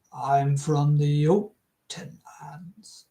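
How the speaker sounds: slow unvoiced whisper of a stranger; hushed stranger. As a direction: breathe the line out slowly.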